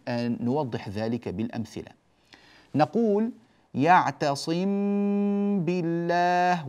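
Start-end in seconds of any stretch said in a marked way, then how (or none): 3.7-6.7 slow, a long hummed meem before بالله; nasal hold on the meem. Shown as a demonstration. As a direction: hold the ghunna in يعتصم بالله. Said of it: "تمتد معه الغنة".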